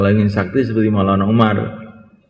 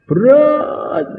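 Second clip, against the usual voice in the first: emphatic heavy. Acted. Stressing how heavy it felt.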